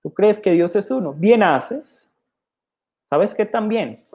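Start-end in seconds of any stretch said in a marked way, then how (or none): 0.0-1.1 mock-innocent question pitched higher; sarcastic. Preacher voicing James mocking believers, sarcastic question. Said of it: "Santiago sarcásticamente dice". 1.1-2.1 higher, mock praise with a rising end; sarcastic. Preacher voicing James's sarcasm, ironic approval. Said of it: "Santiago sarcásticamente dice".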